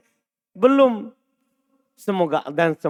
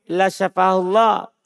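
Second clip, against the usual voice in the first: La syafahullah said slow in one breath, no break after La; La syafahullah read as one phrase, slow and even; run together, misleading; slower than usual. Shown as a demonstration. Teacher showing the misleading flat reading. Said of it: "Itu memberikan sangkaan".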